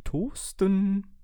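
high, disbelieving question; can't believe what he heard; incredulous. Acted. Owner echoes toaster's odd wish, incredulous.

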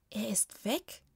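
puzzled breathy whisper; baffled, barely voiced question; confused. A narrator's reading. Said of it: "sagte Franzi verwirrt".

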